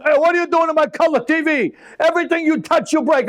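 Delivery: put-on Italian father scolding his son over the TV, in an Italian accent. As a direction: snap at the kid in an Italian accent.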